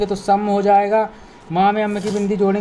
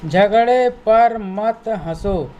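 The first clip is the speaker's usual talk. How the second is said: slow model reading. Shown as a demonstration. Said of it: "teacher slow model reading".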